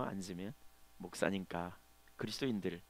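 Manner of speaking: normal talking voice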